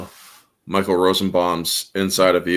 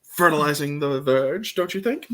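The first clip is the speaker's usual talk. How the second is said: mock posh aside; 7 semitones higher, 3 dB quieter than usual. Joke line in a put-on voice.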